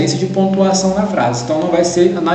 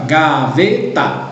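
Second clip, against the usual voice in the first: "gaveta" slowly in three separate pieces, ga, ve, ta; split into syllables; much slower than usual. Shown as a demonstration. Teacher showing the three pieces of a word, "gaveta" syllable by syllable. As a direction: break "gaveta" into ga - ve - ta. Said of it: "é três pedacinhos".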